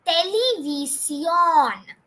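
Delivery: television very slowly, te-le-vee-see-ON; slow Spanish model. Shown as a demonstration. Teacher leading the last repeat, very slow te-le-vee-see-ON. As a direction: draw television right out, long final ON. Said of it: "the last time".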